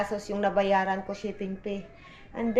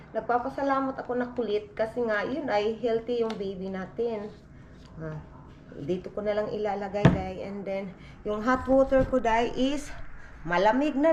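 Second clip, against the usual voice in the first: normal talking voice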